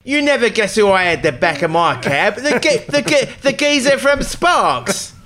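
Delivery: hammed-up Cockney taxi driver showing off his famous passenger; London cabbie accent. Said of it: "how do you do it better than me?".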